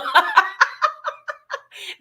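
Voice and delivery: high rising laugh; loud burst of laughter. Real, not acted. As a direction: let a real laugh burst out. Laughs at chat comment.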